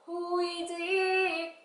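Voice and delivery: high, drawn-out singsong call; mother answering door. Acted. Mother asking who rang the bell, high singsong. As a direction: call out slowly like a mum to the door. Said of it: "엄마는 뭐라 그래요".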